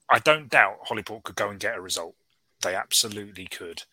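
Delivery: normal talking voice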